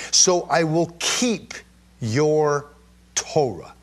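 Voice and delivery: slow, solemn scripture reading. Acted. Preacher reading a psalm to his congregation, slow and solemn. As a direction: read the psalm slowly, with weight.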